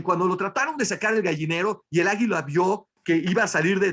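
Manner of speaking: usual speaking voice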